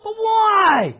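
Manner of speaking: high, loud drawn-out outcry; mock-anguished high yell; protesting wail. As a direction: whine it out loudly in mock protest. Acted protest answering grace.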